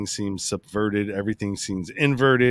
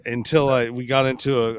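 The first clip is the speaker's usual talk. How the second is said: ordinary talk, nothing marked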